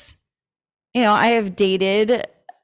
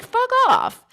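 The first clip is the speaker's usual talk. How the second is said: "fuck off" flung out much higher; 13 semitones higher than usual. Retold or imitated. Telling nosy askers to back off, in a high scornful voice.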